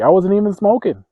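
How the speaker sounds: loud, high protest of innocence; indignant protest. Retold or imitated. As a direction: shout your innocence in outrage. Protesting unfair blame, first take.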